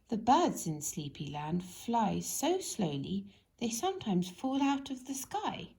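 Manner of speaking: ordinary delivery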